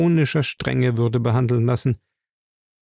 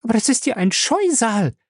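entsetzte junge Frau; 11 semitones higher than usual. Acted. Ella entsetzt über den Chef.

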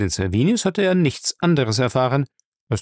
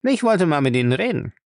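thief's pitched-up, lively voice; cheeky thief Lacey, insolent; 6 semitones higher, faster than usual. Acted. Thief tells his master he won't play servant, insolent.

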